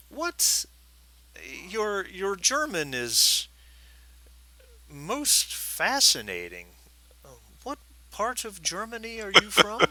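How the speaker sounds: slow, high-pitched curiosity; intrigued gentleman. Acted. German stranger probing the driver's origins, intrigued. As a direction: draw it out, pitch swooping up with fascination.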